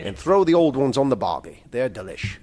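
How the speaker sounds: lightly attempted Australian accent. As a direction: read it with a rough Australian accent. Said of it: "do my Australian accent".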